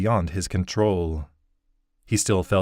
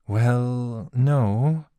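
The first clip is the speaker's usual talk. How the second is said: put-on higher, awkward young woman's voice; Naomi, hesitant; 5 semitones higher, slower than usual. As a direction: hesitantly break into the talk.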